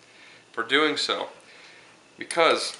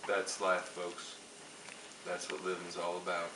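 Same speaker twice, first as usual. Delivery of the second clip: much softer, late-night cooking show hush; volume down; 4 semitones lower, 12 dB quieter than usual. Pretend. Host lowers the volume for late-night viewers, hushed and soft. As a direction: ease it down for the viewers relaxing at night. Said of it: "bring the volume down on the show".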